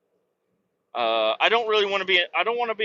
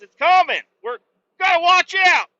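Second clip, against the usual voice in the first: high, loud warning cry; hammed-up shouted alarm; mock alarm; 14 semitones higher, 9 dB louder than usual. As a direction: warn everyone the invasion is coming.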